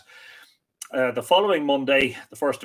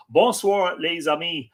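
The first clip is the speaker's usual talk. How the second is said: louder, higher faux French greeting; faux French accent; 5 semitones higher, 5 dB louder, slower than usual. Shown as a demonstration. Host reading Sandrine's greeting to friends, in a faux French accent. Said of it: "a faux French accent".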